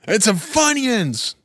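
high, drawn-out call for snacks; bratty stretched yell; Will Ferrell. Acted. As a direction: holler it like a spoiled man-child. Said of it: "Will Ferrell from, uh, Wedding Crashers".

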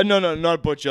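ordinary talk

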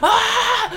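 high, loud scream; freak-out scream. Retold or imitated. Dad freaking out at the concert, screaming. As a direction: scream in tripping amazement.

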